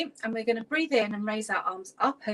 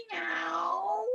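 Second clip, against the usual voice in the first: high rising meow; playful cat meow; 8 semitones higher than usual. Pretend. Laughter yoga teacher leading cat stretches, high playful meow. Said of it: "pretend to be a cat".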